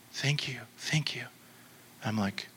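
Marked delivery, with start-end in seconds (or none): 0.1-1.2 imitating a stunned woman's whisper; soft, voiceless and overwhelmed; whispered thanks. Shocked woman's quoted thanks.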